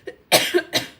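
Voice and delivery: real throaty cough. Real, not acted. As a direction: cough hard mid-sentence. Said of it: "I have a frog in my throat".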